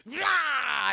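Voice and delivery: pained cry. Retold or imitated. Luke shocked by lightning.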